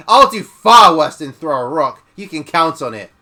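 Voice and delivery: put-on loud, furious old woman; thick with rage. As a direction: snarl the threat at the children. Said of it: "in a voice thick with rage".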